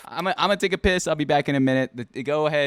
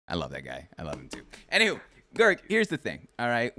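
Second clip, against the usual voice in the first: ordinary delivery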